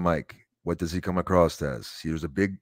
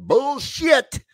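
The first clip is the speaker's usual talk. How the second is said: loud, high mock shout; scoffing shout; 4 dB louder than usual. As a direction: bark it out in disbelief. Scoffing at American claims.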